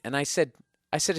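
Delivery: normal talking voice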